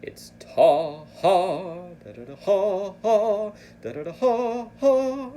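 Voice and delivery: loud, high sung ta ha da syllables pushing each breath accent. Shown as a demonstration. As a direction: voice the flute line as ta and ha, each ha a breath push. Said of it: "as a test of your breath accents".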